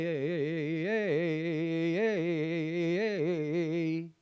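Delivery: ordinary delivery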